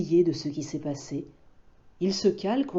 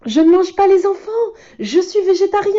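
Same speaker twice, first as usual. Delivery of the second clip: much higher, louder, quick exclamation; relieved cry; 13 semitones higher, 8 dB louder, faster than usual. Acted. Loup cries out to the boy, relieved and high. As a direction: burst out with relief. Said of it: "s'écrie Loup rassuré".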